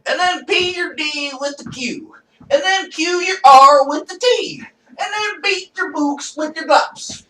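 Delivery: high, loud mock swear code; mock gibberish ranter. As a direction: rant like a shocking wannabe. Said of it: "and walking around".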